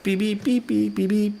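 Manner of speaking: high beeping like a phone app; mock app beeps. Imitating Shazam identifying the song, high beeps. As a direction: chirp like Shazam listening. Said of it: "whipped out Shazam and was like".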